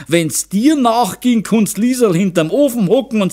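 high-pitched, emphatic lecture; lecturing mother. A narrator's reading. Mother lectures husband on how girls find husbands, emphatic. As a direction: hold forth to your husband. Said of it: "dozierte die Zaunerin".

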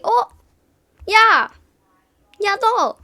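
high, loud excited calls to a best friend; best friend calling out across the hall, excited yell. Retold or imitated. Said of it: "하면서 막 와요".

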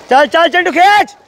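high loud shouted cheer. Real, not acted. Cheering Chintu during the 200 metres, loud shout. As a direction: yell Chintu down the pool.

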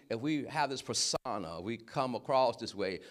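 ordinary talk

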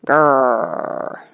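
low, long growled reply; tiger growl. A narrator's reading. Tigers answer the boy with only a growl, growling low. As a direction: answer with a deep tiger "grrr". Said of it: "so they could only say".

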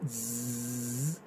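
long low buzzing zzz; drawn-out demonstration of the z; voiced Z sound. Shown as a demonstration. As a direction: sustain a buzzing z on its own. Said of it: "entre dos vocales".

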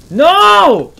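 high, loud cry of despair; anguished scream. Real, not acted. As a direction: scream as death nears. About to die.

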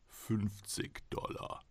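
whispered, slow price offer; hushed bid. Acted. Golem's buyer whispering his falling bid. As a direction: breathe the number out slowly. Golem's man bids quietly.

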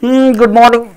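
a sleepy hmm, good morning, as if just woken; sleepy greeting. Shown as a demonstration. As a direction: say it like you never slept. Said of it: "सो के".

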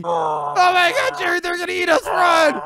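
high frightened yelling; panicked shout. Acted. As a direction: scream at him to flee. Fleeing monsters, shouting.

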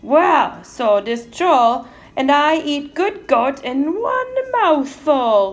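loud, high-pushed troll threat; troll's growl. A narrator's reading. Troll threatening to eat the goat, loud growl. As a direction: threaten slowly and loudly as a mean troll. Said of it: "growled mean Calypso Joe".

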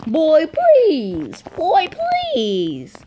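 high cracking please. Real, not acted. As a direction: let the voice break up high. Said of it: "Voice crack".

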